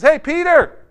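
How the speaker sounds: high, loud hail; calling out. Retold or imitated. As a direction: call over to a friend. Jesus calls out to Peter.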